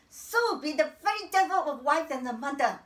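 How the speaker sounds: loud, high, vehement command; fierce exhortation. Acted. Woman urging total devotion as wife and mother, fiercely. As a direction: drive the bitter lesson home hard. Bitter, forceful life lesson.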